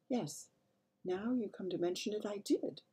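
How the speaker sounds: soft, high murmured admission. A narrator's reading. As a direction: murmur it very softly. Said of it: "the Queen murmured".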